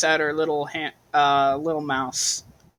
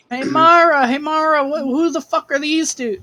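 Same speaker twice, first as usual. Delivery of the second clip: put-on high excited kid shouting; child calling out; 11 semitones higher, 8 dB louder than usual. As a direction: yell for her big sister.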